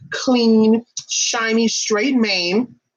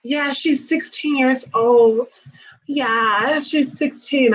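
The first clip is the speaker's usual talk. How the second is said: owner voice; 6 semitones higher, much slower than usual. Retold or imitated. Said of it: "the owner was like".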